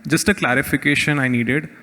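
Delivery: ordinary delivery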